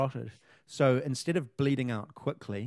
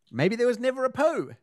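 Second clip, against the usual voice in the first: dramatic; 10 semitones higher, 6 dB louder than usual. Real, not acted. Dramatic speculation.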